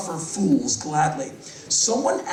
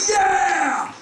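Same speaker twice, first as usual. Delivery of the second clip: higher, louder cheering yell; crowd cheer; 12 semitones higher, 3 dB louder than usual. Retold or imitated. Audience cheering [name]'s put-down, a loud high yell. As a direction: whoop like a roaring crowd.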